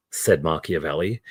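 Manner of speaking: usual speaking voice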